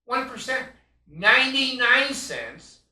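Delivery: high, loud, slow outrage at the numbers; outraged. Real, not acted. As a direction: stress the figures with indignation. Outraged emphasis on figures.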